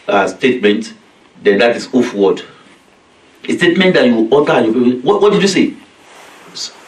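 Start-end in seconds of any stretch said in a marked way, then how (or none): 5.0-5.7 higher-pitched, mildly indignant challenge; talking back. Acted. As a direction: question it like a cheeky child. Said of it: "Oof word is to talk back".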